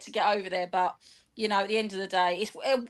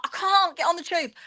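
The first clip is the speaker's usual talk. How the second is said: pitched much higher, pleading; insistent refusal; 8 semitones higher than usual. Retold or imitated. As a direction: push the refusal up in exasperation. Retold argument, escalating refusal.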